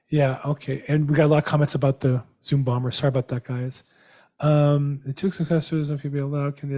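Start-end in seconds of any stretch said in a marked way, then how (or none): none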